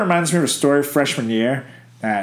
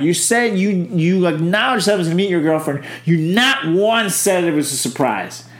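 usual speaking voice

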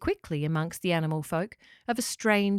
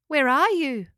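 higher, louder searching call; 6 semitones higher, 5 dB louder than usual. A narrator's reading. Locker calls to the voice in the bush, calling out. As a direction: call out to someone unseen. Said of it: "called out Locker".

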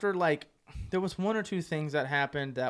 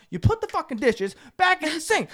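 high, loud angry accusation; pissed off; 13 semitones higher, 5 dB louder than usual. Retold or imitated. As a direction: re-enact an outraged accusation. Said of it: "So I'm pissed".

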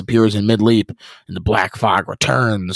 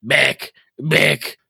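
wild cry; 4 semitones higher than usual. Acted. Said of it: "cries the dark voice wildly".

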